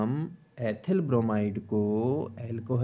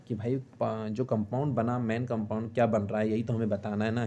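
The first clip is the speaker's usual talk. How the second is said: normal talking voice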